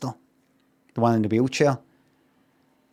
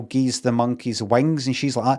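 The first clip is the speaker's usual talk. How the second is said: ordinary talk, nothing marked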